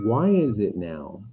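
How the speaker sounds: normal talking voice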